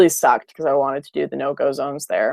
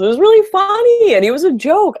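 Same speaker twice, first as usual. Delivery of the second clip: laughing; 7 dB louder than usual. Real, not acted. Laughing about the pug video.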